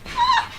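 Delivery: a high, sharp ashamed cry. Retold or imitated. Student recalling her shame, a sharp high cry. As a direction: yelp out in shame.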